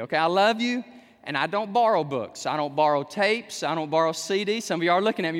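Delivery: ordinary talk, nothing marked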